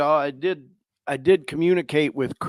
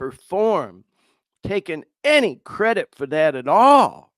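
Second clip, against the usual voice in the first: normal talking voice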